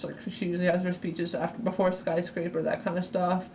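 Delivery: ordinary delivery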